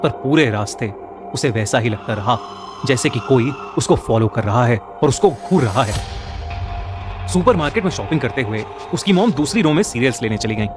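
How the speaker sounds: ordinary delivery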